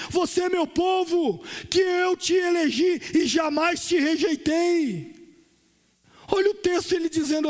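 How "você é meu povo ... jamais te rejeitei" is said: high, slow proclaiming of God's words; fervent preaching. Acted. Pastor voicing God's promise to his people, fervent. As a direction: lift the promise up to the church. Quoting God with fervor.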